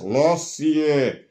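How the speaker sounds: put-on tongue folded old man voice on Lassie; old man voice demo. Shown as a demonstration. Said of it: "it made my voice sound like an old man".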